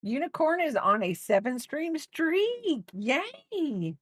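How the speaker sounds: high squeal of praise for a viewer; excited cheer. Real, not acted. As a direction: celebrate the streak like a cheerleader.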